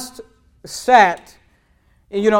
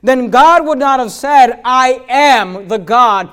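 higher and louder, slow and building; full-force preaching; 4 semitones higher, 7 dB louder than usual. Acted. Pastor driving home the resurrection to his church, building preaching. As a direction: preach the climax with rising force.

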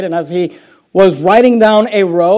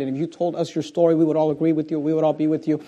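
usual speaking voice

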